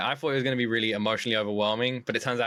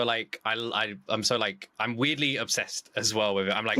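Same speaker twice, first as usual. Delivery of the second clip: ordinary talk, nothing marked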